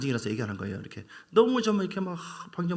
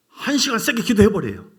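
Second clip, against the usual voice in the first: louder exhortation; 6 semitones higher, 8 dB louder than usual. Shown as a demonstration. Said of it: "이것도 아멘이 없어요".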